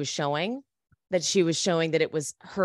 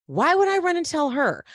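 higher-pitched, incredulous comeback; retold indignant retort; 8 semitones higher, 4 dB louder than usual. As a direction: throw the question back, pitched up.

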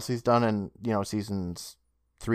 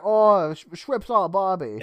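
put-on Australian accent; 7 semitones higher, 4 dB louder, slower than usual. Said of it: "it's either that or".

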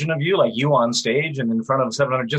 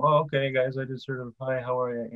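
a mock shy, mumbled hello, much softer; timid mumble; 7 dB quieter than usual. Mocking a star who walks on stage timid, softer. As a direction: shrink away and mumble the greeting. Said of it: "nobody wants to see [name] come out on stage and go".

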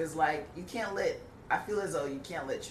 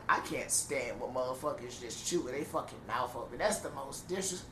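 usual speaking voice

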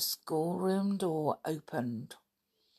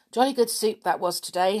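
cheerful; 5 semitones higher, 5 dB louder than usual. A narrator's reading. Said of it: "said Julian cheerfully".

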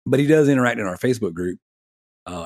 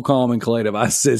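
ordinary talk, nothing marked

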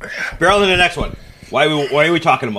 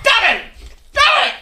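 shouting. Real, not acted. Yelling at friend.

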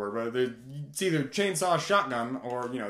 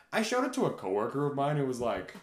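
normal talking voice

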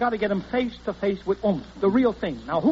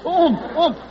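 oomph; 8 semitones higher, 5 dB louder than usual. Acted. Demonstrating oomph sound.

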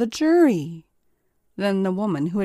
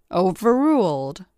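stern order; 4 dB louder than usual. A narrator's reading. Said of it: "ordered Judge Gibbons".

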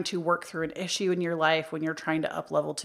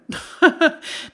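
bright burst of laughter; high laugh; 10 semitones higher, 7 dB louder than usual. Real, not acted. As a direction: laugh out loud. Laughs after the joke.

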